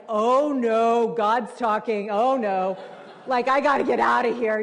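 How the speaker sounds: loud, comic alarm; half-joking panic at hearing God speak; mock panic. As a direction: blurt it out like you're caught. Panicked reaction to kindness.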